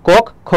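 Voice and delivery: কোক খ split as 'kok... kho'. Shown as a demonstration. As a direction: pause between k and kh in কোক খ. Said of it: "তাহলে ক আর খ হয়". (কোক খ ভেঙে 'কোক্-খো')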